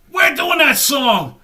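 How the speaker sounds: loud, high, slow protest; retold alarmed shout. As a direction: holler it like an outraged manager on the phone. Manager's alarmed outburst, retold.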